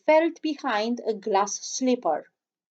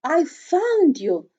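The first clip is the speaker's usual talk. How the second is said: tender prince; 5 semitones higher, 5 dB louder, slower than usual. A narrator's reading. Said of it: "said the king prince".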